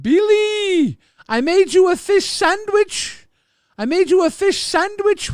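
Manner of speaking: high, loud goofy dad voice; a high, loud movie-quote dad; quoting the dad. Retold or imitated. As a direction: shout the movie line like a silly dad. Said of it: "that's a line from Freddy Got Fingered".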